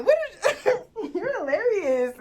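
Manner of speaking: laughing in disbelief. Real, not acted. Laughing in disbelief at her bitter date. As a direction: laugh it off, incredulous.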